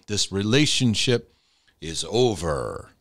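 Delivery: slow, drawn-out pronouncement; mock divine announcement. Pastor mimics the Lord stepping in to end a friendship, slow mock announcement. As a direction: announce it like a referee's call, slowly. Pretend God ending a friendship.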